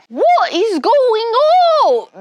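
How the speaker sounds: very slow, high mock British accent; failed British accent. Another botched British take for viewers. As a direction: stretch it out in a bad British voice. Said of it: "I can't do a British accent".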